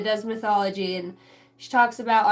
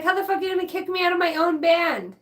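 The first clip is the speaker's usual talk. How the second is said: angry; 7 semitones higher, 3 dB louder, faster than usual. Retold or imitated. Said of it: "He was pissed".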